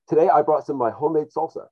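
ordinary talk, nothing marked

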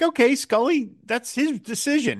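high-pitched mild protest; exasperated concession. Pretend. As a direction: address him as if arguing back. Mock address on a character's behalf.

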